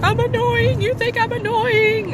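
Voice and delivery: high, loud whiny re-enactment; hammed-up emotional outburst; emotional mock wail. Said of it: "So I've been super emotional".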